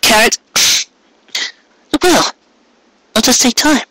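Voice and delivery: put-on high young mare's voice, eager and a little rushed; young mare, earnest. A narrator's reading. Mare explains to Carrot why she kept him secret, earnest. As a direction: voice her high, pushing through the explanation. Mare reasons through the plan.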